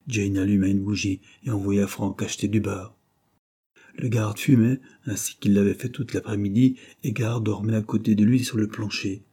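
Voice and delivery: ordinary delivery, nothing marked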